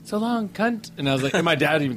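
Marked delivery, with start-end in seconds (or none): 0.0-0.9 higher, lightly voiced Southern belle; a hint of a sweet Southern mother; Southern belle mom. Mom sees off a moving neighbor, lightly voiced Southern belle. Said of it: "my mom's a Southern Belle".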